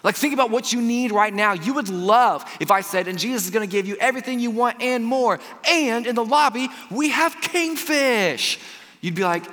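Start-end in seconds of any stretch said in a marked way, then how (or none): 3.1-8.6 high, building promo pitch; hyped-up announcement. Acted. Preacher promising the congregation everything they want, hyped-up announcer.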